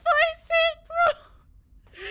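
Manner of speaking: mock outburst, very high and loud; high-pitched squeal. Streamer joking about grandmother, high-pitched squeal. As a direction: squeal it out as a joke. Retells own remark, jokey outburst.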